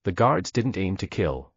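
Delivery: plain reading voice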